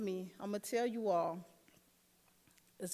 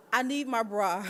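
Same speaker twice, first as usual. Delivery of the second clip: laughing insistence; 8 dB louder than usual. Retold or imitated. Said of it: "And I'm like".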